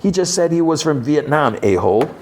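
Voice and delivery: high-pitched mock exasperation; exasperated correction. [name] corrects Red's insult, mock exasperated. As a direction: correct him in a high, fed-up retelling.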